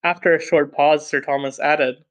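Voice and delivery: plain reading voice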